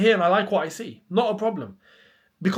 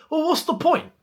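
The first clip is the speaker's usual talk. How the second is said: high, exasperated what's the point; exasperated question; 9 semitones higher than usual. Real, not acted. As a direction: throw up your hands at a pointless sale. Exasperated at the Disasi sale.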